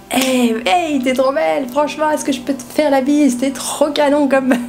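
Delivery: high, gushing party voice; tipsy chat-up at the club. Retold or imitated. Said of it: "quand t'as quatre grammes d'alcool". (drague éméchée en boîte, voix aiguë)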